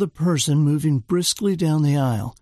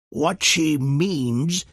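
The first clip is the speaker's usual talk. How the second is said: Churchill feigning patience; much slower than usual. A narrator's reading. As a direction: explain it with put-on patience. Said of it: "with feigned patience".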